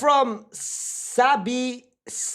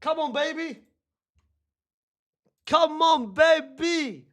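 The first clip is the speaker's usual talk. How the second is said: excited cheer; 7 semitones higher than usual. Real, not acted. Celebrating goal.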